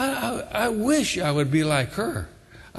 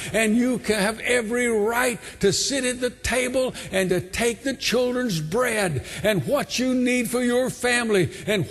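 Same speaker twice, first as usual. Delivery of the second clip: raised, insistent preacher's voice; fervent preaching; 7 semitones higher than usual. Real, not acted. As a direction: build from emphatic exhortation to high-pitched urgency. Preaches the altar call at full force.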